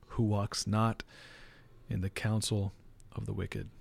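quiet, very slow reading with long pauses. Shown as a demonstration. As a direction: read aloud phrase by phrase, slowly. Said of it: "this is how slow I read it".

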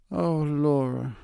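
high, slow, exasperated "Oh, Laura"; drawn-out sisterly reproach; annoyed sister. Acted. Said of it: "Joes began to be seriously annoyed".

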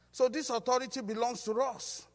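loud, high, driving sermon voice; preaching at full force. Acted. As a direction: preach it out to the whole church. Preacher builds again.

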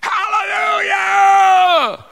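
very high, very loud, drawn-out cry; screamed hallelujah. Retold or imitated. Seminary student bursting into class after a friend's salvation, ecstatic scream. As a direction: scream it with all your joy. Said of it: "and I screamed".

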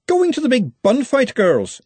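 high, loud market-woman greeting; cheerful. A narrator's reading. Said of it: "said Verity cheerfully".

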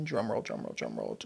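mock drum roll. Building suspense before last list item.

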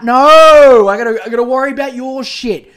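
loud, sharp no; a sudden loud no in the middle of a rant; loud outburst. Real, not acted. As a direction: cut yourself off with a loud no. Ranting outburst.

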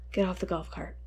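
quiet, cold order, anger held down; calm mad grandpa. Retold or imitated. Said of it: "He's, like, calm mad".

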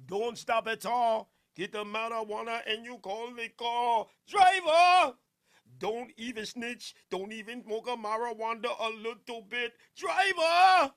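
loud Jamaican patois sing-along, shouting [name]; [name] impression. Streamer quoting his favorite Jamaican artist, Jamaican patois. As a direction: belt it like a Jamaican dancehall hook.

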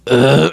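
short mock burp noise; imitated burp. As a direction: act out a burp mid-story. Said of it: "when he burps and he goes".

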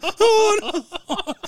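high, hammed-up wail; mock crying. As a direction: imitate a woman bursting into tears. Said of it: "she starts crying, like, you know, emotional".

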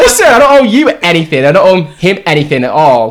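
firm telling off. Retold or imitated. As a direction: put the stranger in her place. Retold telling off stranger.

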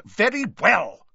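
loud, high, exasperated interruption; teacher losing patience. A narrator's reading. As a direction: cut the pupil off sharply. Snape cuts Colin off angrily.

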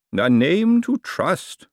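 smooth, knowing lawyer holding forth; lawyer's voice. A narrator's reading. Lawyer explaining the lease to Miss Dearhart, lightly voiced.

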